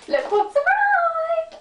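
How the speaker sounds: very high, drawn-out excited squeal; giddy, sky-high outburst of delight; hyperactive. Real, not acted. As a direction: squeal it, stretching the last word. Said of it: "I went really hyperactive then".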